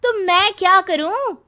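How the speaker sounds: high, loud daughter snapping back; irritated retort. Acted. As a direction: brush mother off with sharp impatience. Daughter snaps at lonely mother.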